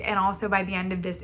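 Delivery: ordinary talk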